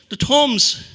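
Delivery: high, loud, squealed exclamation; indignant squeal about the thumbs; outraged. Retold or imitated. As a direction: blurt it out in disbelief.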